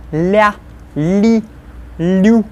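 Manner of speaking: la, li, lu with a light, soft l. Shown as a demonstration. Teacher modelling the soft L syllables. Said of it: "And soft".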